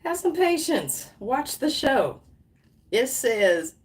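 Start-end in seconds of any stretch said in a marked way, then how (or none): 0.0-2.2 high-pitched, insistent playful instructions; raised, emphatic mock command; voice of the universe. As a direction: call it out high, like cheerful orders from above. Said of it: "Universe said".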